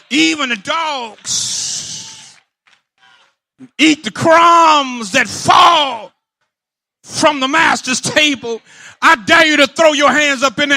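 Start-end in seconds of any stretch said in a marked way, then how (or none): 0.1-8.6 slow, stretched, full-voiced; preaching climax. Real, not acted. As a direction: drag out every word for effect.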